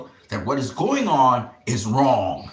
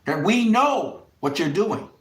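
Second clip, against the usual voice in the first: raised, pitched-up warning, jabbing the words; angry tirade; 7 semitones higher, 3 dB louder than usual. Real, not acted. Vlogger warns world leaders in a raised, angry voice. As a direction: scold them like you're pointing right at them. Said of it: "I'm sorry I had to sound like that".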